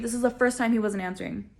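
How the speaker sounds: ordinary talk, nothing marked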